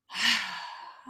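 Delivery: long breathy out breath; exhaling sigh. Shown as a demonstration. Teacher repeating the shoulder release for the class, audible sigh. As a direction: sigh the held breath away. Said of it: "So breathing again".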